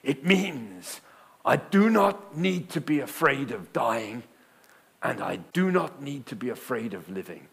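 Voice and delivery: lightly voiced Ugandan accent, slow and earnest; a touch of Ugandan accent, wide-eyed and earnest. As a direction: quote his friend in a hint of a Ugandan accent. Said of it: "pardon the accent".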